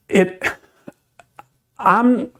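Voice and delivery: normal talking voice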